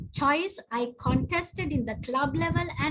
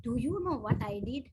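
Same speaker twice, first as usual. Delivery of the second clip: ordinary delivery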